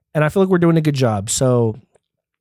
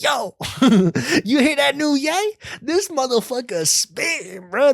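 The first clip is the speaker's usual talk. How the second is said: much higher excited mock voice; hyped barbershop guy; 13 semitones higher than usual. Fan in the barbershop hyping new [name], high and excited. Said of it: "you're like".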